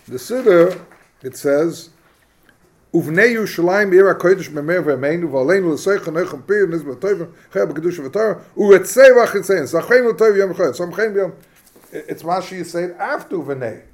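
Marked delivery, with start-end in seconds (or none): 2.9-11.3 reads the Hebrew liturgy aloud a bit louder; reciting the prayer text. Shown as a demonstration. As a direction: recite it like reading from the siddur. Reads from the siddur.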